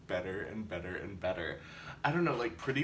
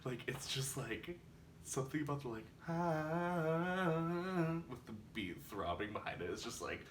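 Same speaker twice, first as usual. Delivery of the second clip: soft, hushed, awed; quiet; 2 semitones higher, 7 dB quieter than usual. Real, not acted. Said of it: "an intense reaction".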